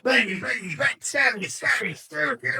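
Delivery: high-pitched burst of pretend shots and stabs; panic-fire at an unstoppable enemy; frantic mock attack. Private frantically attacks the advancing man.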